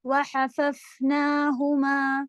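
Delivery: وحففناہما very slow, with the نا drawn long; long madd. Shown as a demonstration. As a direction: stretch the نا in وحففناہما. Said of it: "نا بڑا". (وحففناہما میں نا لمبا)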